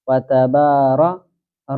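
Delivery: وتبار with the را held long, slowly; wrong drawn-out ra. Shown as a demonstration. Teacher drags وتبار to show what not to say. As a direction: draw the را out as the mistake. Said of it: "را نہیں کہنا".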